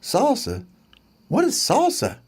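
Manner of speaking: high, baffled sparrow voice; puzzled sparrow. Acted. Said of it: "said the sparrow".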